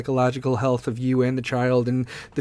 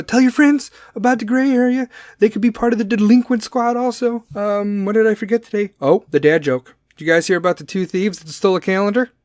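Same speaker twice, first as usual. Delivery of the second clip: ordinary delivery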